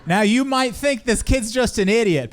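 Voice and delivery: ordinary talk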